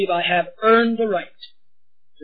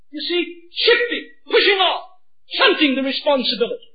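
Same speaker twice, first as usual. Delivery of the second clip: impassioned preaching; 8 semitones higher than usual. Acted. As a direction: preach it at full force. Preaching at full force.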